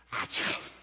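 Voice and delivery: soft voiceless mock sneeze; imitated sneeze. Preacher mimicking daughter-in-law's video, soft whispered sneeze. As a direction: whisper out a little achoo. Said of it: "she would video herself just".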